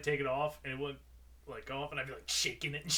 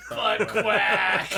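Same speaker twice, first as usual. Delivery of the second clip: laughing echo; 7 semitones higher, 11 dB louder than usual. Real, not acted. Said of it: "echoes the punchline".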